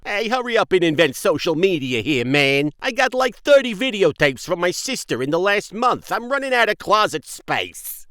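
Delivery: put-on brash Brooklyn street kid griping; Brooklyn accent. Joey complaining about sister's tapes, loud Brooklyn accent. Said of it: "Joey has this thick accent".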